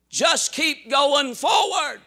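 slow, drawn-out "forward" swooping up then down; mock exhortation. Preacher throwing hollow counsel back at congregation, mocking. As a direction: parrot the advice with exaggerated, stretched emphasis.

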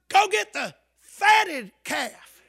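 slow, high, loud order; father's command. Acted. Father ordering servants to fetch the calf, shouted. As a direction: call it out joyfully to the servants.

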